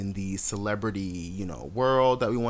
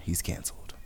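sarcastic whisper, much softer; whispered punchline; 6 semitones lower, 9 dB quieter than usual. Real, not acted. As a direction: drop to a whisper for the joke.

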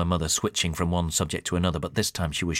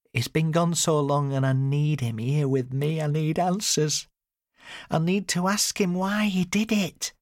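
high, slow, drawn-out plea; high-voiced, distressed mother wanting answers; upset mother pleading; 10 semitones higher than usual. A narrator's reading. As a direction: plead it slowly in a raised pitch.

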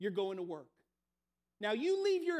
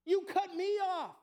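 high, loud, outraged what-did-I-do; playing the wronged driver, pitched up; high slow indignant protest; indignant disbelief; 7 semitones higher, 4 dB louder, slower than usual. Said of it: "And you're like".

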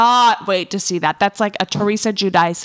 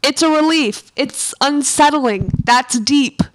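higher, louder mock choked-up Jeff; imitating Jeff choking up; 5 semitones higher, 5 dB louder than usual. Jeff congratulating his ex, mock choked-up and forced. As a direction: force each word out like it barely comes. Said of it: "choking on your words".

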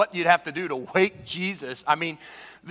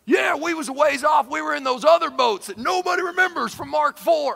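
high, loud folksy boatman holler; hollering boatmen; 8 semitones higher, 4 dB louder than usual. Acted. Forgotten men in the other boats speak up, hammed-up holler. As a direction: pipe up loudly as a forgotten bystander.

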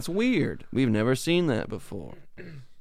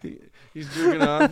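ordinary delivery

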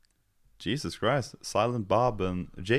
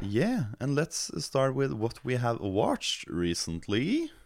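podcast host intro; 7 semitones higher, slower than usual. Acted. Slow bright segment intro.

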